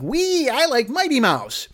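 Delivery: put-on high, slow gleeful kid voice; high squeaky childlike delight. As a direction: sound like a delighted child fan.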